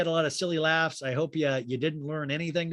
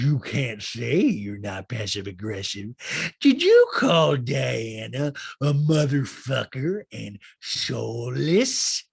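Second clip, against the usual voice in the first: slow, drawn-out caricature building louder; Rinna; 2 semitones lower, 6 dB louder, much slower than usual. Retold or imitated. Housewife confronting Sutton over calling Diana names, slow hammed-up accusation. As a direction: stretch every word into a mock confrontation. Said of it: "And then Rinna goes".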